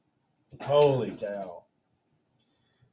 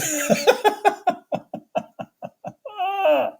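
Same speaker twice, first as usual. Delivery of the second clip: long high laughter. Real, not acted. Amused at own face.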